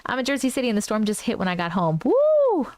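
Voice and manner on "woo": high, loud whoop; excited whoop. Real, not acted. Streamer whoops at the storm hitting, high and loud. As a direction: let out a thrilled holler.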